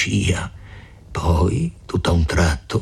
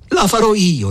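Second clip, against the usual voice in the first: high, forceful interruption; angry vow; 11 semitones higher, 4 dB louder than usual. A narrator's reading. Renzo cuts in on Agnese's caution, angry vow. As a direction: jump in high and defiant. Renzo vows his own justice.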